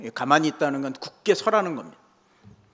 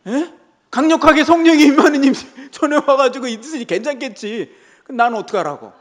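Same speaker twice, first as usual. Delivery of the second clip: higher and louder, half-incredulous; exasperated; 7 semitones higher, 7 dB louder than usual. Retold or imitated. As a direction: throw up your hands in disbelief. Exasperated retelling of the young man.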